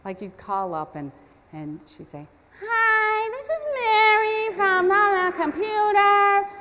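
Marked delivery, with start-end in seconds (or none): 2.6-6.5 high, loud, drawn-out singsong whine; whiny caller. Retold or imitated. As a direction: mimic a grating phone caller with a hammed-up whine. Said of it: "the most whiniest voice I ever heard".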